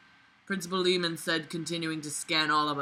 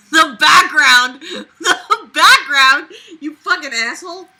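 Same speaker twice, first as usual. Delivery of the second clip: loud laughing cry of the background; laughing shout; 8 semitones higher, 14 dB louder than usual. Real, not acted. Reader laughs out loud at the silly scream line. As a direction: crack up while shouting it.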